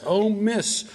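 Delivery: high, drawn-out pleading that falls away on "miss"; acted-out wail of protest; pleading drunkard. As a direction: beg desperately through withdrawal pains. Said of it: "going through, uh, some withdrawal pains here, and he says".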